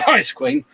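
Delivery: a pointed mock cough that lands on the name; fake cough. Hinting who to blame, mock cough with the name. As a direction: cough the name out, high and sly. Naming her behind a cough.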